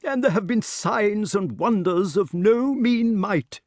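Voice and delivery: high, slow, portentous; self-important. A narrator's reading. Pellinore announces the signs, self-important. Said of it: "feeling important".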